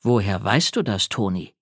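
low, sober question; very serious. A narrator's reading. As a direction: turn suddenly earnest and probe. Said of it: "fragte er mich plötzlich ganz ernst".

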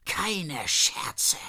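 hissed threat, angrier; menacing whisper. Acted. Fanny threatens the boy in a menacing whisper. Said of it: "Fannys Stimme wurde immer böser".

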